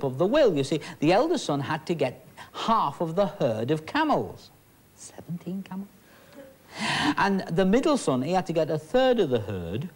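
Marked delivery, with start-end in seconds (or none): 4.9-5.9 whispered aside. Acted. Aside during the story.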